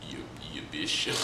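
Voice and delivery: low, soft muttered insult; low grumbled insult. Retold or imitated. Hashirama cursing his dad, low and soft. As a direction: grumble it low under the breath. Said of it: "Hashirama's like".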